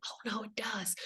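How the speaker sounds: a hushed, confident "oh, no, it does"; whispered aside to the doubters; whispered rebuttal. Real, not acted. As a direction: whisper it, knowing better. Rebutting imagined sceptics.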